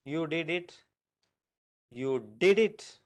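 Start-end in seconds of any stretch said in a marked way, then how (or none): 1.9-3.0 DID hit loud and drawn out; stress on DID. Shown as a demonstration. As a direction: slowly punch the word "did". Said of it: "emphasis that I am giving".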